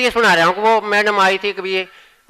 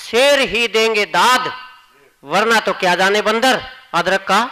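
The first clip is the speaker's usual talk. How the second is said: ordinary talk, nothing marked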